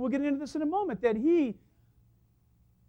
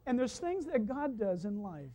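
ordinary talk, nothing marked

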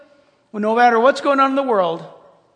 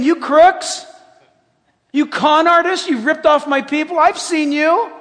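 loud, high denunciation; God's accusation; 5 semitones higher, 6 dB louder than usual. Acted. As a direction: confront the crooks as an accusing God. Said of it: "I like when he talks like that".

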